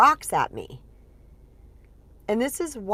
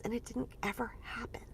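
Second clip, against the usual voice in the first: whispered, much softer; 3 semitones higher, 14 dB quieter than usual. Real, not acted. Confessing to listeners it never worked, whispered. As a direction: breathe it out as a rueful whisper. Rueful aside after laughing.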